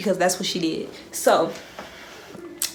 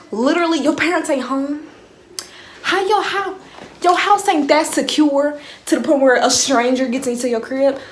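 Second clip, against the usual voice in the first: high, loud incredulous rant; 8 semitones higher, 4 dB louder than usual. Real, not acted. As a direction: rant in disbelief, pitched up.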